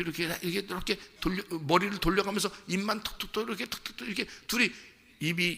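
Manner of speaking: ordinary delivery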